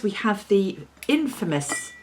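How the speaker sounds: normal talking voice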